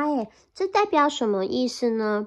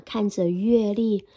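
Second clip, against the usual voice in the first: usual speaking voice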